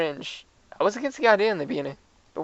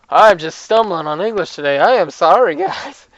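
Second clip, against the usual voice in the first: much louder, exasperated at yourself; big playful outburst of embarrassment; loud self-mocking apology; 10 dB louder than usual. Real, not acted. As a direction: announce your own stumble loudly, half laughing. Owns up to misreading, jokey apology.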